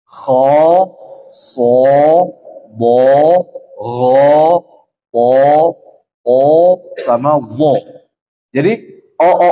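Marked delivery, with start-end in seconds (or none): 0.0-7.8 "kho, so, bo" with raised tongue root, thick and louder; heavy letters demo. Shown as a demonstration. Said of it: "Hurufnya ini". (huruf isti'la "kho, so, bo" tebal)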